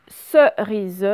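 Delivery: CERISE loud and slow, in separate syllables; teacher breaking CERISE into syllables; split into syllables. Shown as a demonstration. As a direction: sound out CERISE, leaning on the opening SE. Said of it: "c'est au tout début dans ce".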